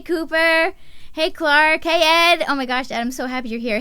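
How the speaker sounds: ordinary delivery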